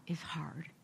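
soft whispered prayer; whispering. Acted. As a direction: let it fall to a whisper.

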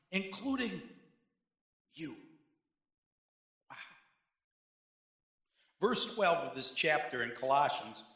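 ordinary delivery

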